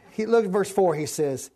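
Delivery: normal talking voice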